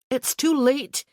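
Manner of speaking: high, loud alarmed warning; a sudden frightened call; alarmed cry. Acted. Alarm as horse whinnies.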